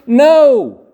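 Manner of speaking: sharp high shouted No; emphatic no. Acted. Preacher crying out No to the crowd. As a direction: cry out the No to the congregation.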